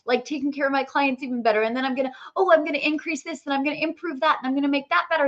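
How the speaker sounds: fast, high, breathless list of plans; frantic rushing. Retold or imitated. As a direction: rattle off plans in a frantic rush.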